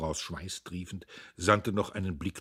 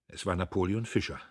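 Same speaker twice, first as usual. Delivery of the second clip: usual speaking voice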